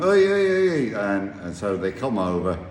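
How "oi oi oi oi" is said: loud high calling out; shouting oi. Retold or imitated. Calling the guards from the hut, loud shouts. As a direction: shout across to get their attention. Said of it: "I start shouting".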